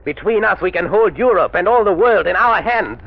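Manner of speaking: grandiose pitch. Acted. Villain's grand offer.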